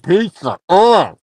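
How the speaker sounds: talking while licking fingers; fingers in mouth. Real, not acted. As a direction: fingertips against the lips. Seen 2: Man in cap licks and sucks his fingers at his lips while talking.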